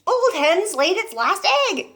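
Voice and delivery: put-on high, slow jeering old woman; mock the poor hen; gloating shout. Grandma mocks the hen from the roof, high and slow. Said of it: "Grandma shouted".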